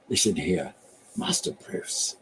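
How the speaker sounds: low, slow, hushed Cockney cadence; Michael Caine. Acted. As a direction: pace it slowly and softly, dropping low. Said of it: "it's that slow cadence that".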